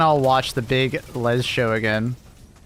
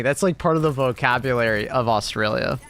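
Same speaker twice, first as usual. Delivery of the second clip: normal talking voice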